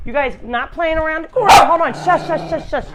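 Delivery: sharp, high, loud hushing at the dogs; scolding the dogs. Real, not acted. Owner hushing her barking dogs, loud and high. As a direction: snap at the barking dogs to hush.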